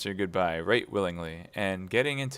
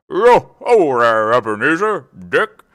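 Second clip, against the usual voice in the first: much louder, higher, slow rich calling out; jovial fat voice; 4 semitones higher, 14 dB louder than usual. A narrator's reading. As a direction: hail the apprentices jovially across the room. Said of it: "comfortable, oily, rich, fat, jovial voice".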